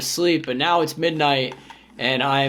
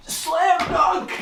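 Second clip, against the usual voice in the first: much higher, excited call; hyped shout; 14 semitones higher than usual. Real, not acted. Calling his slam dunk attempt, hyped and much higher. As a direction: hype up the shot.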